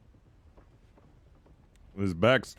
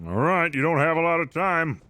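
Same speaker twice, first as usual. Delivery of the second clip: character voice; 8 semitones higher, 3 dB louder than usual. Acted. In-game character warning.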